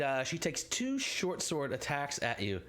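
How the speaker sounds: ordinary delivery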